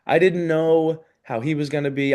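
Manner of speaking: ordinary talk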